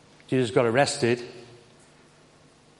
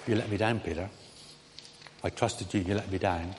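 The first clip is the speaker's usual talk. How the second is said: low, hushed disappointment; quiet reproach; 5 semitones lower, 6 dB quieter than usual. Retold or imitated. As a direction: voice a softly spoken reproach. Imagined reproach from the Lord to Peter.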